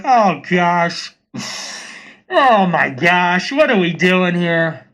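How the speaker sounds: slow, drawn-out groaning "oh gosh"; exasperated dismay. Acted. Onlooker reacting to a messy e-girl spat, exasperated dismay. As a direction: draw it out in weary mock dismay.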